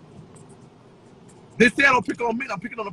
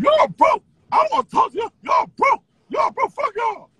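high, loud jeering chant; sing-song insult to viewers; mocking taunt; 11 semitones higher, 5 dB louder than usual. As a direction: sneer it at the chat, pitched up and loud.

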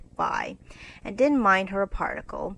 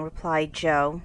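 plain reading voice

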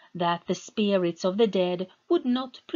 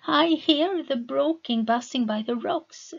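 put-on higher, louder, delighted girl's voice; smiling; 6 semitones higher, 4 dB louder than usual. Meta, now calm in the water, delighted and smiling. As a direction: say it with a happy smile. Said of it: "she smiled".

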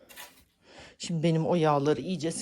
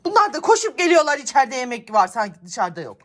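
exasperated complaint; 7 semitones higher, 10 dB louder, faster than usual. Real, not acted. Fuming about the cats.

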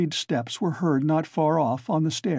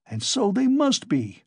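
higher, astonished; astonished cry; 8 semitones higher than usual. A narrator's reading. Coke realizing someone is inside, astonished. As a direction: gape at the idea. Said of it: "cried Coke, astonished".

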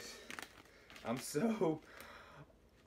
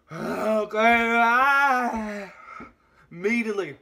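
pained outburst; 4 semitones higher, 8 dB louder, slower than usual. Real, not acted. Heat building in the mouth.

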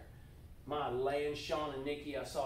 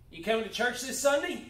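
brighter, louder, higher invite; friendly invitation; 6 semitones higher, 5 dB louder than usual. Shown as a demonstration. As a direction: call out a cheerful church invite. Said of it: "Simply by saying".

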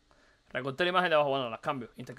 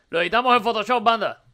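same line again, louder and brighter in pitch; more energetic retake; louder repeat; 3 semitones higher, 5 dB louder than usual. Shown as a demonstration. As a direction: punch it up the second time. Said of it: "repeated louder".

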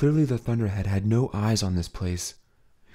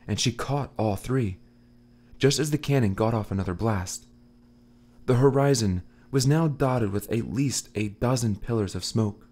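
ordinary delivery, nothing marked

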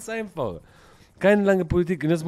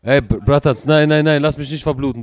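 urgent shouted begging, lower pitch; loud pleading; 6 semitones lower, 11 dB louder than usual. Pretend. Wounded character begs not to bleed out, loud plea. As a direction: beg loudly for your life in play.

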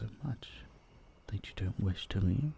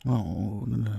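ordinary talk, nothing marked

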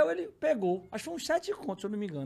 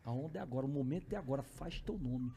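softer, much lower egging-on; low urging aside; 7 semitones lower, 7 dB quieter than usual. Retold or imitated. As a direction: coax a friend on the quiet. Friend urging him on, in an aside. (incentivo baixinho ao pé do ouvido)

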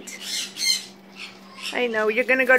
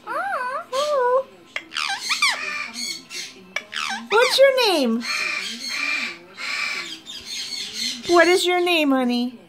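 pet talk; 5 semitones higher, 4 dB quieter, slower than usual. Real, not acted. Owner asking her bird its name, high baby talk.

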